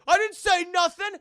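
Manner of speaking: high, loud nervous denial; hammed-up panicky denial; guilty blurt. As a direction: blurt it out too fast and too loud. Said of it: "already you look so guilty".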